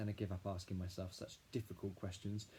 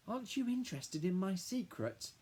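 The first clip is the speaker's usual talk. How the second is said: put-on excited little boy; 10 semitones higher than usual. A narrator's reading. Little brother Billy telling Dad about the mud, high eager kid voice. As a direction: gush like a small boy bursting with news. Billy pressing his sister.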